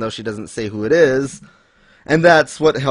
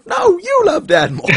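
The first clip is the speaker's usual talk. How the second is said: very high, loud squeaky comeback; hammed-up falsetto bickering; mock retort; 5 dB louder than usual. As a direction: shriek it back like a squabbling kid. Acted retort between kids.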